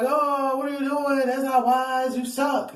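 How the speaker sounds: mock the doubters; theatrical mocking critic. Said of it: "I'm theatrical".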